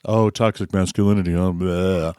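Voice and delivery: put-on low voice, scornfully dismissive; mock dismissive grumble. Mimicking people who label things.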